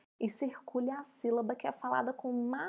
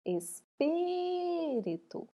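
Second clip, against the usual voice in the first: "Espírito" called high with a long held PÍ; calling out; 6 semitones higher, much slower than usual. Shown as a demonstration. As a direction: call the word "Espírito", stretching PÍ. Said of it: "Vamos chamar pra confirmar?". (chamando "Espírito", com o PÍ alto e esticado)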